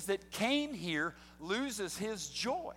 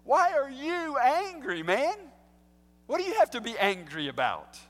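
God challenging Cain, louder and higher; God questioning; 6 semitones higher, 6 dB louder than usual. Pretend. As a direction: press him like an incredulous God. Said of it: "I think that's a good question".

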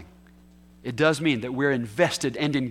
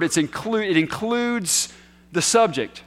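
ordinary talk, nothing marked

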